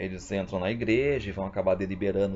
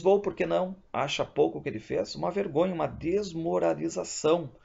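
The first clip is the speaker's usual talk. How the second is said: bispo indignado; 7 semitones higher than usual. Acted. Bispo indignado com o padre.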